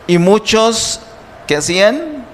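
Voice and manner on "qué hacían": usual speaking voice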